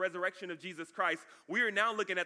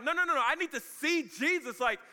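high, insistent protest; lightly acted doubter demanding proof; doubting Thomas; 6 semitones higher, 4 dB louder than usual. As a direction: protest urgently in a raised voice. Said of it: "Thomas is like".

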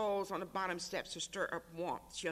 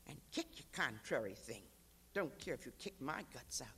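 whispered and softer, near tears; tearful whisper; 5 semitones lower, 4 dB quieter than usual. Acted. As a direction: breathe it through tears to the baby. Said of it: "She cried".

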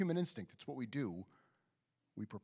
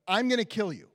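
angry threat; 9 semitones higher, 12 dB louder than usual. Acted. Esau threatening Jacob.

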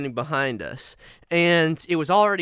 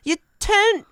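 very high, loud Cockney voice; hammed-up Cockney millennial GPS; 7 dB louder than usual. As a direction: give directions as a squeaky Cockney sat-nav. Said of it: "Cockney G P S millennial".

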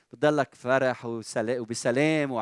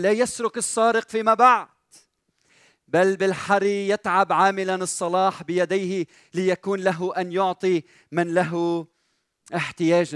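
loud, raised preacher's reading; scripture declamation; 5 semitones higher, 5 dB louder than usual. Acted. Preacher quotes the apostle Paul to the congregation, scripture declamation. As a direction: proclaim the verse to the room.

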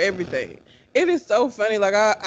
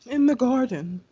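mock British; 3 semitones higher than usual. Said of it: "call backyards gardens".

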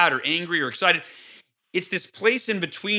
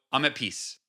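peaceful exhale; 5 semitones lower, 7 dB quieter than usual. Shown as a demonstration. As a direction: lower and much softer, after a deep breath. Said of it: "take a deep breath and go".

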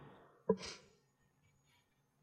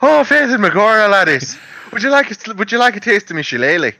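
hammed-up Irish brogue, higher; stage Irish; 8 semitones higher than usual. Mocking a GameStop clerk as a stage Irishman, higher brogue. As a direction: lay on a jokey Irish lilt.

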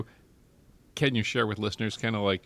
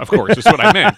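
laughing; 8 semitones higher, 12 dB louder than usual. Real, not acted. Joking about Bridget amid laughter.

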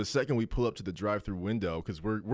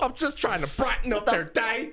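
high, slow and louder, whiny; mock hurt barista; 13 semitones higher, 5 dB louder than usual. Friend voicing the barista's hurt feelings, high and whiny. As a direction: wail it like an offended barista.

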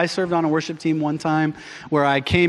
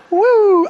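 high loud whoop; a sudden loud high whoo as it all flies away; woo; 8 dB louder than usual. Retold or imitated. Discipline flying out the window.

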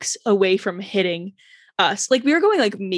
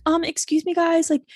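put-on timid teen worker; 4 semitones higher than usual. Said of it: "they were like".

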